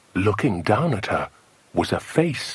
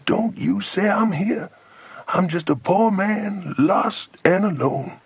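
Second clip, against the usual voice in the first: higher, pleading American voice; raised-pitch American drawl, slowing at the end; American accent; 9 semitones higher than usual. A narrator's reading. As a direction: plead as a lost American man. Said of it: "He sounded like an American".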